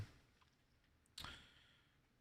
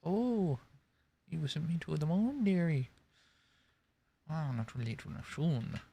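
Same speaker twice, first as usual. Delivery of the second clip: lightly put-on Purgatorian accent, higher; a hint of a fantasy-world accent; invented fantasy accent; 6 semitones higher than usual. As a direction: slip into the made-up Purgatorian accent. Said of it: "Why do I keep doing that accent?".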